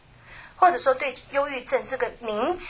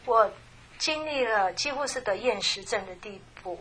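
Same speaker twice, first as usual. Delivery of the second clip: ordinary talk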